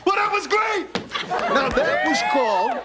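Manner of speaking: laughing, high-pitched proud whoop; excited cheer. Acted. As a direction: cheer him on like a proud drunk dad. Dad cheering son's first beer.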